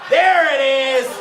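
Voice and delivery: loud, high, drawn-out cheer; jubilant shout. Real, not acted. Comic to crowd once they laugh, jubilant shout. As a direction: stretch it out and holler it.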